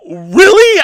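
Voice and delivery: retold surprise. Supervisor amazed by compliment.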